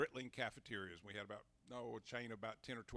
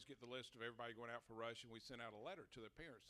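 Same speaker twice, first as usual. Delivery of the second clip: usual speaking voice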